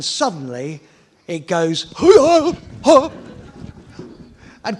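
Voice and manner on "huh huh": high, loud jolting gasps; a motorbike shimmy imitated with sharp high bursts. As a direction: mimic the bike's sudden wobble. Bike starts shaking.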